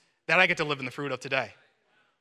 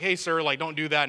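ordinary talk, nothing marked

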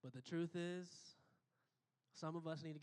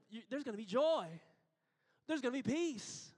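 rising preacher's fervour, higher and louder; preaching at full force; 6 semitones higher, 3 dB louder than usual. Acted. Pastor urging the congregation, full preaching force. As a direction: build it up like a Sunday climax.

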